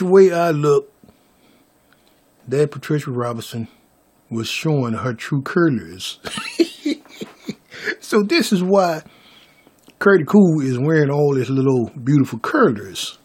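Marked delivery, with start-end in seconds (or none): 6.2-6.9 very high short giggle; quick squeaky giggle. Real, not acted. Storyteller laughing about her true colors, high giggle.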